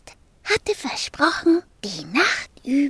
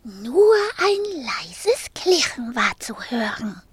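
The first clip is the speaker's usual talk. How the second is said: usual speaking voice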